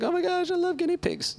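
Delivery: high, gushing squeal over cute pets. Retold or imitated. Mocking people gushing over guinea pigs. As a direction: gush like someone at a petting zoo.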